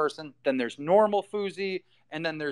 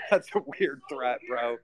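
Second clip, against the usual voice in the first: laughing it off; 5 semitones lower than usual. Real, not acted. Laughing off a threat.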